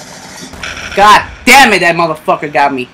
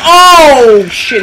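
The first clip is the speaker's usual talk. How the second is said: startled yell; 9 semitones higher, 10 dB louder than usual. Real, not acted. Killed, yells out in surprise.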